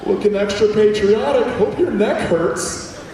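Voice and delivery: mock American small talk on picture day; hammed-up cheery American. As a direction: overdo the friendly neighbor.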